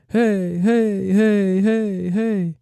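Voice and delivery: high heys in steady quarter rhythm; quarter-note echo hey. Shown as a demonstration. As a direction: repeat hey like a quarter delay. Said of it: "one fourth would be like".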